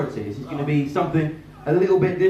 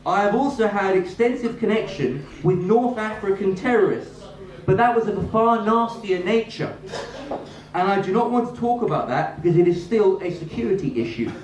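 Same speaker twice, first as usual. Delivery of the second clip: raised-pitch formal read of a Lords speech; reading a speech aloud; 6 semitones higher than usual. Acted. Reading a peer's speech to the audience in a formal voice. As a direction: read it like the parliamentary record, formally.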